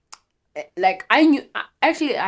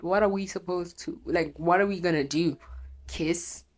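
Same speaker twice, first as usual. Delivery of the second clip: ordinary talk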